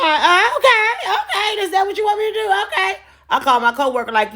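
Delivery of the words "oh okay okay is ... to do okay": much higher, laughing, forced okays; fake cheerful agreement. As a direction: squeak out a mock-happy yes while fuming.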